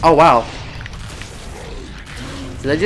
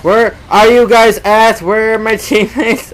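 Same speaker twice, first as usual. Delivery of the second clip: higher, louder, slow call for teammates; 8 semitones higher, 7 dB louder than usual. Real, not acted. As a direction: shout out across the map for help. Calling out for absent teammates.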